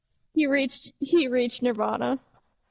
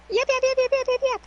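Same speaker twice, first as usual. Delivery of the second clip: mock yapping dogs; 12 semitones higher, much faster than usual. Imitating dogs barking at her.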